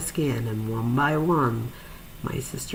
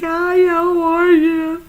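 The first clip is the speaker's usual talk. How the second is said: high, loud and slow, voice cracking, trying to hold back sobs; a strained high greeting on the edge of crying; forced cheerful hi; 11 dB louder, much slower than usual. Retold or imitated. Greeting her sister in hospital, holding back tears. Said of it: "here comes me sobbing".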